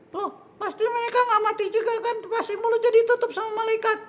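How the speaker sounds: very high squeaky puppet voice; questioning little puppet. Acted. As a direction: reason aloud in a tiny high-pitched voice. Puppet predicts the ending.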